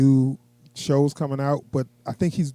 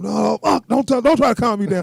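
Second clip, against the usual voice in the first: mock agitated protest, higher pitch; imitating overexcited man; 6 semitones higher, 3 dB louder than usual. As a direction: play him getting too worked up. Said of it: "he get a little too excited".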